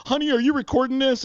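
high mock-agitated call; agitated appeal. Driver asking his partner to film the stop, high and agitated. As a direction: imitate a worked-up driver calling to his partner.

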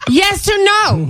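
drawn-out, high-pitched mock yell; exasperated demand. Girlfriend demanding an answer from boyfriend, exasperated. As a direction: stretch it out in comic exasperation.

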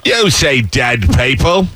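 drawn-out mock Australian movie quote; hammed-up Aussie take on a film line; Australian accent. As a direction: stretch it slowly in broad Australian. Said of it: "Australians do a great".

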